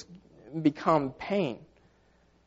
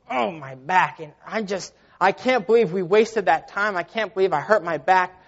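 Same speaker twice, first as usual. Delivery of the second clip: whiny, higher-pitched griping; mock complaining; 3 semitones higher than usual. Second monk griping about his sore back, complaining. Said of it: "He just was complaining".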